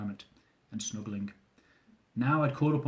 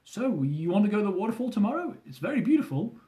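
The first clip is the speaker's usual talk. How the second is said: higher, cheerful host; Min's voice; 8 semitones higher than usual. A narrator's reading. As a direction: offer it brightly. Min voiced in story.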